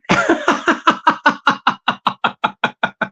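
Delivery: long run of rapid, evenly pulsed laughter; rattling laugh at your own joke; long staccato chuckle. Real, not acted. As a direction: laugh in a steady machine-gun rattle.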